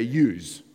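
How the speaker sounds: "yous" with a z sound on the end; "yous", rhyming with "news"; Bogan plural "yous". Shown as a demonstration. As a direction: say "yous", the slangy plural of you. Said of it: "If you're Bogan, forgive me, then you say".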